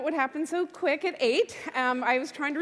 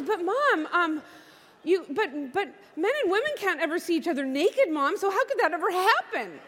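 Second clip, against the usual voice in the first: put-on higher-pitched little girl's protest; puzzled child; 4 semitones higher, 3 dB louder than usual. Daughter to mom about men and women being naked, baffled. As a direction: object like a baffled kid.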